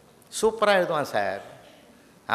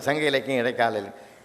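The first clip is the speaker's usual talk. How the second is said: lower, softer repeat trailing behind; imitated echo; 4 semitones lower, 4 dB quieter than usual. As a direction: echo the speech back, dropping away. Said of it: "அதே repeat ஆகுது".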